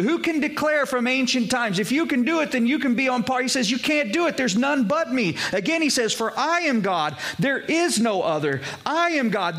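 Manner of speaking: preaching at full force. Acted. Pastor proclaims Isaiah's verses to his church, ringing preaching. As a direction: lift the voice and proclaim like a pulpit preacher.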